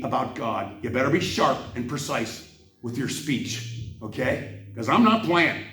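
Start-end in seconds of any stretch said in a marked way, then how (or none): 4.8-5.6 higher, louder heated declaration; fired-up defiant rant; coming in hot. Real, not acted. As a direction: push hard, daring them. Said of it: "I'm coming in hot".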